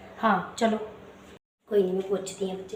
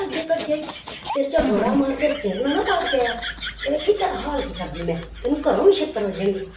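a brash rural mother picking a fight; scolding village mother. Acted. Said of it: "ਸਾਡੀ ਪੇਂਡੂ ਮਾਂ ਵੀ".

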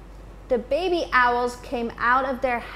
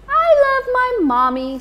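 very high, fast, louder little voice; tiny owl overjoyed at mommy; happy baby owl; 13 semitones higher, 7 dB louder, faster than usual. Acted. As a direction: gush it like the smallest owl. Bill greets returning mother.

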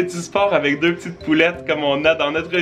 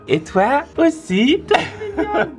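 high, sweet cooing voice; 9 semitones higher than usual. Real, not acted. Cooing at baby daughter.

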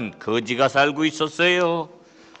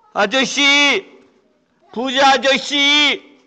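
higher calls up to the house, the second slow; calling out; 7 semitones higher, 3 dB louder, much slower than usual. Acted. Beggar calling the rich man from outside. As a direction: call out so the rich man hears.

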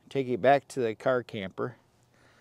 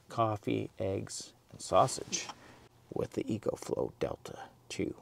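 whispering; 7 dB quieter than usual. Real, not acted. Whispers at night in camp.

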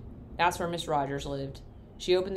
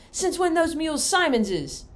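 high, loud country cousin protesting; outraged yokel, pitched way up; indignant Cousin Homer; 12 semitones higher, 7 dB louder than usual. Acted. Cousin Homer protesting to Pa, high and loud.

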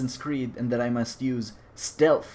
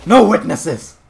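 loud, mock-menacing shout; battle cry; 3 semitones higher, 10 dB louder, slower than usual. Killer charging more witnesses, loud battle cry. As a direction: shout it as a rule.

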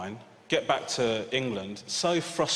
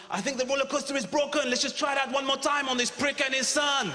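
higher, fast sneering attendant voice; imagined French attendant; 11 semitones higher than usual. Retold or imitated. Ride attendant mocking a paranoid dad, higher sneering voice. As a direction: play the snide ride worker, pitched up. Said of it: "he must have gone".

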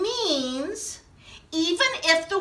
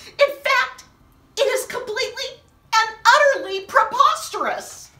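loud, higher haughty complaint; mock indignant; 7 semitones higher, 5 dB louder than usual. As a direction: sound outraged, pompously.

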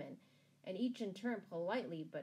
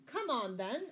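raised, higher command; ordering; 5 semitones higher, 6 dB louder than usual. A narrator's reading. Sheriff to the old palmer at the gallows, ordering. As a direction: hurry them along with authority. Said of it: "ordered the sheriff".

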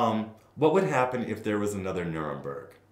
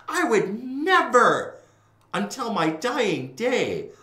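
much higher, slow, drawn out with a laugh; emphatic vow; 11 semitones higher, 7 dB louder, much slower than usual. Real, not acted. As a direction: stretch each word to swear it.

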